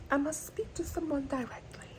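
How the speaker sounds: high, hushed mock-British accent; fancy British accent. Vlogger savouring drama line, soft fancy British accent. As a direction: repeat it softly in a put-on fancy British accent. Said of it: "it just feels so fancy".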